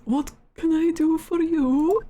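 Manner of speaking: put-on slow, botched Russian accent that slides Scottish; a very poor Russian accent, drawn out; crewman's badly faked Russian accent. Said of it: "very poor Russian accent".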